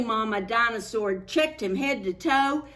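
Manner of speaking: plain reading voice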